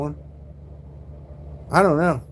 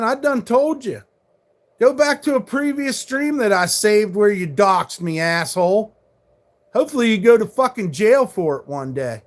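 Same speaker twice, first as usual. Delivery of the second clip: angry; 5 semitones higher than usual. Real, not acted. Continued angry rant.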